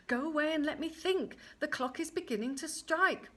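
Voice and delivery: high, urgent pleading order; panicked dismissal. Acted. Queen hears the clock strike, panicking. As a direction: shoo him off in alarm.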